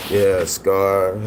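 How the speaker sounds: can't hold the laughter in; laughing. Real, not acted. Retelling Scar's roast, he cracks up laughing.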